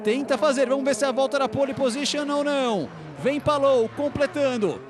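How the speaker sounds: building live play-by-play, rising toward the finish; motorsport commentator. Acted. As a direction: call the lap live, tension building. Live qualifying lap call.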